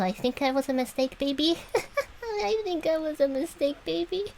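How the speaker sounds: high-pitched, giggly admission; laughing confession. Real, not acted. Joking about herself, breaks into laughter.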